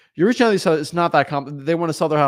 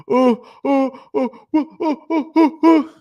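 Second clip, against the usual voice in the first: high, loud panicked oh-oh-oh; imitating a seller freaking out, pitched way up; hammed-up flustered yelps; mock panic; 11 semitones higher, 8 dB louder, slower than usual. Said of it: "They start flipping out".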